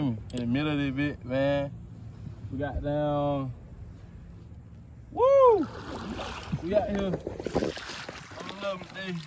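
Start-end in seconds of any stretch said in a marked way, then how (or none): none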